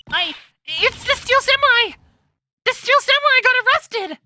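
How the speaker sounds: panicked Maya. Acted. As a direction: blurt the shocking news.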